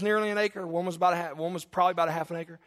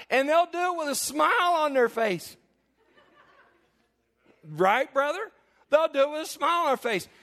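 laughing; 8 semitones higher than usual. Real, not acted.

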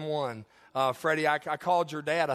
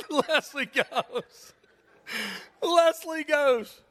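laughing; 10 semitones higher, 4 dB louder, much slower than usual. Real, not acted. Laughing retelling wife's reaction.

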